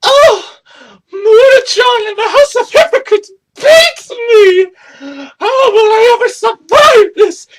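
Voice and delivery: high, whiny fake sobbing shout; mock crying whine. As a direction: mimic a crybaby wailing over a loss, hammed-up. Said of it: "You were sitting here crying and moaning".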